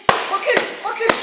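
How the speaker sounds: loud, forceful order to back off; shouted command. Shown as a demonstration. Instructor shows warning off an attacker, shouted command. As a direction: shout it sharply to stop an attacker. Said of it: "je peux parler aussi. Je peux lui dire".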